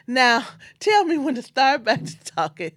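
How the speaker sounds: chuckling mother ready to perform; laughing mother. Retold or imitated. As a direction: say it through a laugh, teasing. Mother joking while being recorded.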